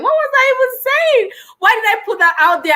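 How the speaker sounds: high, incredulous self-questioning; mock dismay. Acted cringe at first video.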